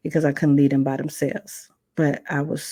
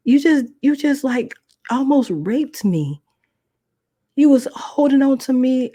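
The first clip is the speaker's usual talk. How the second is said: much higher and louder, outraged; furious shout; 9 semitones higher, 5 dB louder than usual. Retold or imitated. Young girl to her abuser, shouting outrage. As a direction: hurl it at him in fury. Confronting the man who attacked her.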